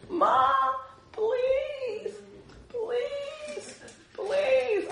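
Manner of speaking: put-on high pleading child's whine; begging child. Preacher as a boy begging his mom, high whiny pleading. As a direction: beg mom like a little kid. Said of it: "I was one of those kids".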